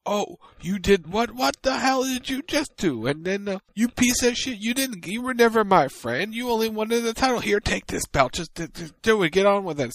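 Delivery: high, indignant mock rant; hammed-up betrayed wrestler; mock outrage. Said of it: "M J F is like".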